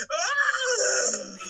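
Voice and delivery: high, loud mock scream; imitated scream. Fan imitating Columbia leaving the room, shrill mock scream. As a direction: scream it out theatrically.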